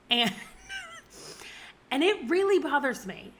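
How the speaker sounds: ordinary talk, nothing marked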